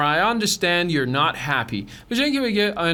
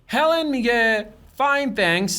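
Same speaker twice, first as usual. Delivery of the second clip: normal talking voice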